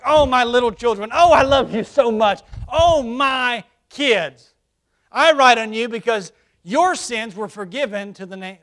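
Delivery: put-on loud, fond cry to dear children; affectionate endearment. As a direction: gush over them like a loving father. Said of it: "It's like".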